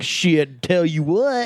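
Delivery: comic Southern drawl punchline; Southern accent. Shown as a demonstration. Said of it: "End it on the fucking Southern accent".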